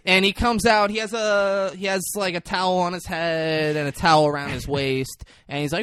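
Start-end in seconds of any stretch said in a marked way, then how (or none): none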